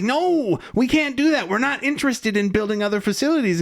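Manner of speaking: high, fast, hurried refusal voice; mock Zorn refusal. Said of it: "And he's like".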